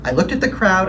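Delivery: ordinary talk, nothing marked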